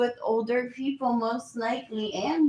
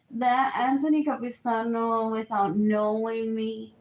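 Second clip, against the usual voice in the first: ordinary talk, nothing marked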